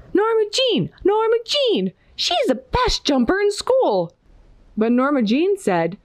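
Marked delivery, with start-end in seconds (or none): 0.1-4.2 storybook shout. A narrator's reading. Classmates nominating Norma Jean for the jumping contest, high mock shouting. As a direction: call it out high like an excited class. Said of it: "Everybody shouted".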